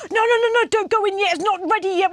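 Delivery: high-pitched, fussy string of orders; mock fretting. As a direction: imitate a grandad frantically stopping the kid. Grandad stopping kid entering camper.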